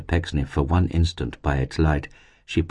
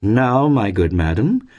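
higher, slow, courteous address; smooth gentleman; 6 semitones higher, 4 dB louder, slower than usual. A narrator's reading. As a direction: open grandly, drawing out the words. Pecksniff opens a smooth request.

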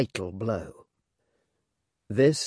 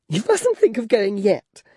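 put-on high, girlish, fluttering chatter; coquettish Flora; 9 semitones higher, 4 dB louder than usual. Flora fishing for compliments from Clennam, high and girlish. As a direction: play the flustered former belle.

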